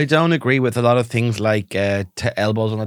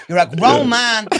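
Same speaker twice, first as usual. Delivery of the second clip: higher, louder, fast nagging wife; mock furious wife; 8 semitones higher, 7 dB louder, slower than usual. Playing a wife scolding her husband over spaghetti. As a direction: rant like a fed-up Irish mammy. Pretend marital row.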